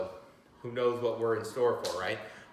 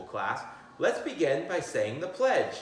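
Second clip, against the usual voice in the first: usual speaking voice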